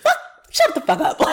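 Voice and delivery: high laughing shut the fuck up; laughing outburst. Real, not acted. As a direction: let it burst out through laughter.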